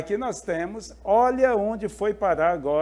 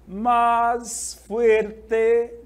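loud, drawn-out MÁS FUERTE with each word held; slow emphasis; 5 semitones higher, 5 dB louder than usual. Shown as a demonstration. Teacher reading the key phrase to students, slow emphasis. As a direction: stretch out MÁS FUERTE, louder and higher.

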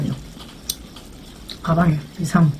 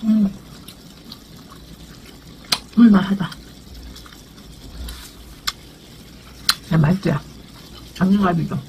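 talking while chewing meat; muffled through food bite; mouth full chewing. Real, not acted. Seen 2: Bites rib at -3; puffed cheeks, chewing, tissue at mouth throughout.

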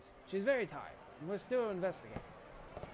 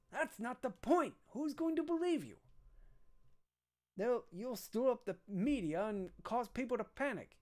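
higher, heated telling-off; angry scolding; 5 semitones higher than usual. Acted. Captain scolds Aya for talking to the press, heated. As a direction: scold her for blabbing to the press.

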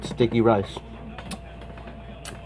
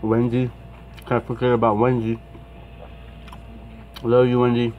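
talking while chewing; chewing food. Real, not acted. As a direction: mouth partly full of food. Seen 3: bite taken at -2, jaw and lips working while talking.

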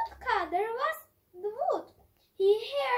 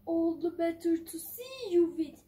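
low, sly wolf voice; 4 semitones lower than usual. Acted. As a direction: purr it like the big bad wolf. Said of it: "said the wolf".

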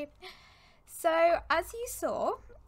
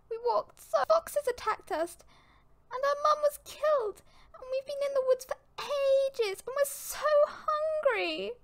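high, wailing kitten in tears; hammed-up tearful squeal, stretching the last words; through sobs; 14 semitones higher, 3 dB louder than usual. A narrator's reading. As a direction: wail it out like a lost, hungry youngster. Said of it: "Through the sobs".

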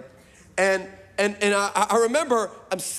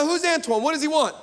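higher, sharp questioning; suspicious wife; 7 semitones higher than usual. Retold or imitated. Wife at bedtime questions him about the texts, higher. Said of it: "She said".

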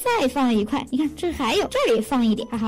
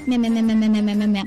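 rapid, low "meh-meh-meh" drinking sound; imitated gulping noise; 5 semitones lower than usual. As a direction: pretend to guzzle milk noisily. Acts out drinking up the milk. (模仿大口喝奶的声音)

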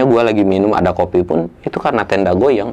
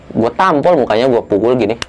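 normal talking voice

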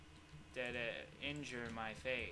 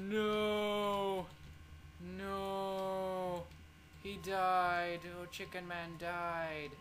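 dismayed; 6 semitones higher, much slower than usual. Real, not acted. Pet character dies.

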